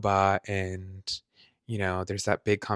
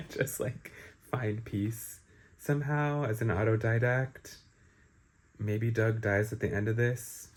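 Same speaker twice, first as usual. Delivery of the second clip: normal talking voice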